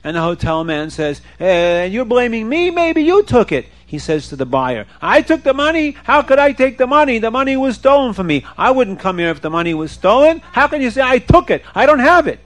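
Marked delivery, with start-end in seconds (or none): 1.4-3.7 rising, offended comeback; acted affront from the accused; indignant retort. Hotel man turns accusation back. 5.0-12.4 high, loud incredulous denial; acted indignation, pitched up; outraged protest. Buyer protests being accused.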